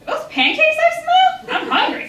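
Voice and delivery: put-on high, loud eager girl voice; bright wheedling child begging; pleading for pancakes.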